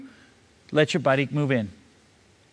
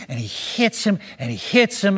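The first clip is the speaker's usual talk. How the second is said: usual speaking voice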